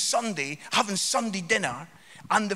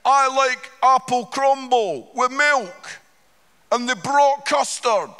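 louder, slow heckle; a mild mock heckle, louder; heckler shouting; 4 semitones higher, 7 dB louder, slower than usual. Fringe heckler shouting out his crumble complaint. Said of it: "he shouts out".

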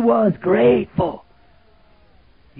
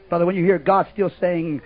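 usual speaking voice

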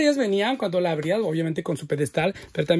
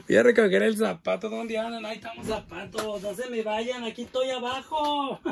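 laughing while searching; 6 semitones higher than usual. Real, not acted. Host hunting fallen shoes on the floor, giggly. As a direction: keep laughing as you hunt.

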